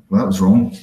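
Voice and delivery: ordinary talk, nothing marked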